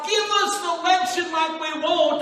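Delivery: high, loud crying out to God; desperate plea shout. Retold or imitated. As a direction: plead at full voice as the congregation once did. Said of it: "We cried out to God".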